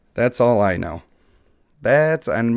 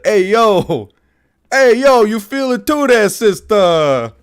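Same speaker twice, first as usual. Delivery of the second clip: much higher, louder Jersey Shore bro; Jersey Shore guy; 10 semitones higher, 10 dB louder, slower than usual. Acted. Streamer tries a Jersey Shore guy for chat, high, loud and hammed up. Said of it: "I wish I could do a Jersey Shore guy".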